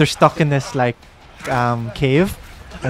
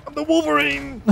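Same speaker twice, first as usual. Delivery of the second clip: high hammed-up Wolverine boast in a fake Italian accent; mock Italian accent; 13 semitones higher than usual. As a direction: belt it like a cartoon Italian hero. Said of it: "What accent was that? That was not Italian".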